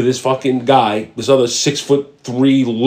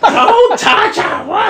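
high, drawn-out mock screech; retold scream; much slower than usual. Counter lady screams at the first responder, mock screech. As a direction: imitate a woman screaming in alarm. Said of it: "fucking screams at me".

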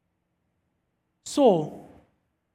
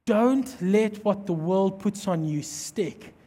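tender fatherly God; 4 semitones lower, 5 dB quieter than usual. Retold or imitated. God voiced speaking tenderly.

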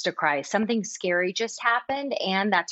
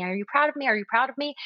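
put-on higher, eager little kid asking; child voice; 6 semitones higher, much faster than usual. Mom imitating her daughter begging for praise, higher. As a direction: pitch up like a pleading small child.

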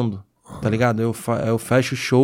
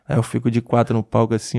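normal talking voice

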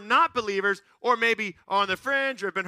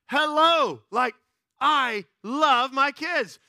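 loud, high, drawn-out protest; indignant comeback; 7 semitones higher, 6 dB louder, much slower than usual. Retold or imitated. Dad answering strangers' pity, indignant. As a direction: spell it out slowly and emphatically, exasperated.

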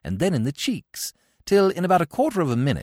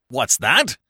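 mock bark; 3 semitones lower, 3 dB louder than usual. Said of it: "barked old Blumenfield".

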